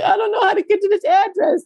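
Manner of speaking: high, laughing mock whine; high laughing mock plea; laughing whimper. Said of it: "so I'm like".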